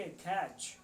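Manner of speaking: ordinary talk, nothing marked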